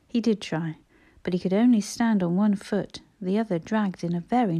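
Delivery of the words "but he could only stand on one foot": ordinary delivery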